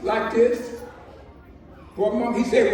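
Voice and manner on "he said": usual speaking voice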